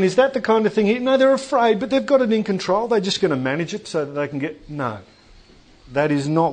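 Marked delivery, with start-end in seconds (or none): 0.0-4.7 doubting listener. Pretend. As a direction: voice the objector, higher and brighter. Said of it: "But you might be thinking".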